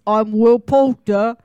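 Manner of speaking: loud, drawn-out bad British accent. Friend showing Gage how an actor sounds, bad British accent. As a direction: do Will Poulter in a badly faked British accent. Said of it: "doing bad British accents".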